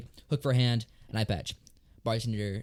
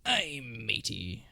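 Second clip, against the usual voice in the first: slow pirate drawl; 4 dB quieter, much slower than usual. Acted. Pirate joke punchline voiced.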